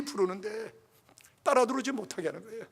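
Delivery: normal talking voice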